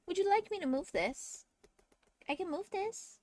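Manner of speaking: soft, high coaxing voice to a pet; cat talk. Real, not acted. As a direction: coo gently to the cat. Talks to her cat.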